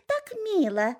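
cooing turkey hens. Acted. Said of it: "шейками покивали и сказали". (ещё выше, с умилением)

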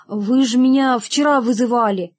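plain reading voice

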